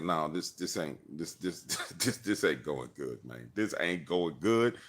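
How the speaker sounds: low, slow, halting worry; stammering dread. Retold or imitated. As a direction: stumble over the words in dismay. Retold panic in the storm.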